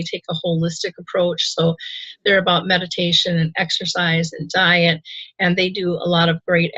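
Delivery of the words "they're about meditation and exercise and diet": normal talking voice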